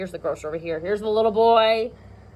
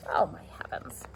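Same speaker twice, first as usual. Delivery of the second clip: hushed, dismayed whisper; 9 semitones lower, 9 dB quieter than usual. Real, not acted. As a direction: breathe it out in a whisper. Aside to child, dismayed.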